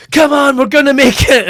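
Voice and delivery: half-shouted war-film heroics; urgent rallying cry. Acted. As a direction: urge your pals onward under fire. Acting out Normandy beach soldiers.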